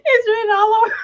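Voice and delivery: high, loud words burst out through laughter; cracking up as she shouts it; laughing shout. Real, not acted. To her viewers, laughing hard and shouting. Laughing while calling out Jazz.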